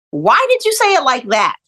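high-pitched, taken-aback protest; indignant. Retold or imitated. Retold indignant thought, acted out.

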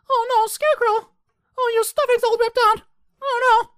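horrified piercing mock falsetto; very high, loud hammed-up dismay; Dorothy impression. Dorothy finding Scarecrow torn, mock falsetto dismay.

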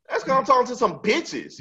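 high-pitched defensive retort; mock-defensive squeal of an excuse; indignant protest. Defending himself to his wife.

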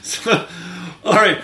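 high laughing wind-down. Real, not acted. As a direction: laugh it off and regroup. Laughing after horse joke.

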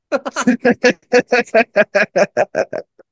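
loud, high burst of laughter; loud laugh at a joke on himself. Real, not acted. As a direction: crack up loudly. Laughing at his own story.

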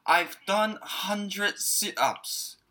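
very slow, each word laid out clearly; drawn out for the learners to follow; slow model sentence. Shown as a demonstration. Teacher showing the English dialogue, very slow and clear. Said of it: "これを英語に直すと".

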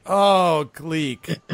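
mock exasperated goblin groan; eye-rolling exasperation. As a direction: start high and loud, then drop away wearily. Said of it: "they roll their eyes like".

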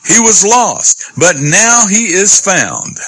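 high, slow, drawn-out proclamation; crying out. Acted. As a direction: cry it out as the rejoicing father. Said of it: "but he did cry out".

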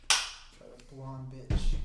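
drawn out very slowly. Real, not acted. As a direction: stretch every word out slowly. Reading beer label, drawn out.

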